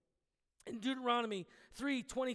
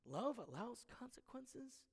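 much softer doubting question on "Love allows consequences?"; incredulous question; 13 dB quieter than usual. Retold or imitated. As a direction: voice the listener who can't believe it. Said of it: "that phrase is hard to really grasp".

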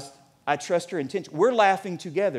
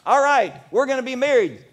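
cocky, higher and louder naive groom; naive newlywed; 7 semitones higher, 5 dB louder than usual. Retold or imitated. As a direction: announce it with breezy overconfidence. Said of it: "like, going".

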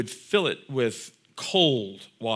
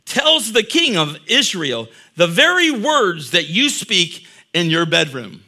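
full-voiced preaching; 4 semitones higher, 6 dB louder than usual. Preacher retelling a king's demand to his congregation, raised preaching voice. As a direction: read the king's story out loud and raised, like from a pulpit. Preaching the scripture story aloud.

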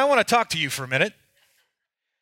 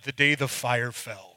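low, portentous mock book title; mock-grand title; 7 semitones lower, 4 dB quieter than usual. Janitor dreaming up his memoir title, mock-grand announcement. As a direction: lower the pitch for a pompous title reveal.

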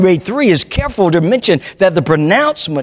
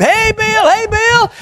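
put-on much higher, bright call of a little boy; child calling out; 14 semitones higher, slower than usual. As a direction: call out like an excited five-year-old. Boy calls out to garbage man.

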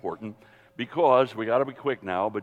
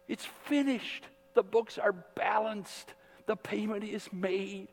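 impassioned preaching; 10 semitones higher than usual. Acted. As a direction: lift it up like a triumphant sermon peak. Preaching climax, raised pitch.

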